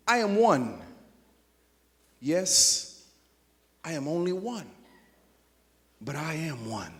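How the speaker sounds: slow, measured poem reading with long pauses; solemn poem recital; poem recitation. Acted. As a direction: recite each line slowly, letting it land.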